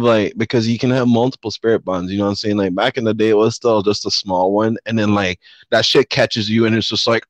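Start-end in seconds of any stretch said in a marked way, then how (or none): none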